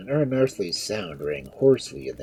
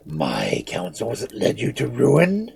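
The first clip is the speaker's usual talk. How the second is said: slow, raised, scornful question; bitter scorn; 6 semitones higher, 5 dB louder, slower than usual. A narrator's reading. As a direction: throw the charge back slowly and higher. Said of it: "one of his bitter laughs".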